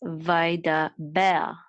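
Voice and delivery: vaidaber with the final BER stressed; vai-da-BER, weight on the last syllable. Shown as a demonstration. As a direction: lean on "ber" at the end of vaidaber. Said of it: "accent mark is on the last syllable".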